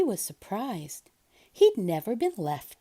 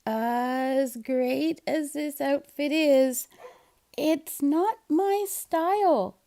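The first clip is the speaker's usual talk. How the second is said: slow, higher, careful refusal; Seymour's polite refusal; 5 semitones higher, slower than usual. Acted. Seymour turning down Kitten's outfit, polite. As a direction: decline politely but firmly. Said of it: "he said".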